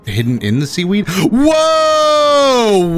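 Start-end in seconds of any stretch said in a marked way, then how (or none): 1.1-3.0 long, high gasped cry of amazement; gasp, then draw the cry out high; amazed. Real, not acted. Amazed by a Pokemon sight.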